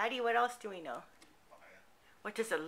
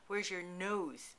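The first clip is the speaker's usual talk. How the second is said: normal talking voice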